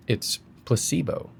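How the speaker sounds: normal talking voice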